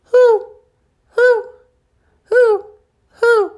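high, loud owl hoots. Acted. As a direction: hoot like an owl, high and spaced. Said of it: "sound like an owl".

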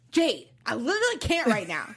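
hammed-up irritation, mocking him; mock attitude. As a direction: play up his huffy tone. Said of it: "Sean has an attitude".

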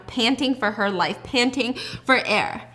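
ordinary delivery

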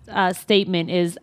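ordinary delivery

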